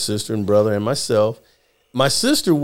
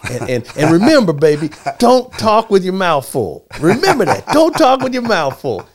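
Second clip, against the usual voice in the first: insistent mom; 6 semitones higher than usual. Retold or imitated. Mom's retold warning, repeated.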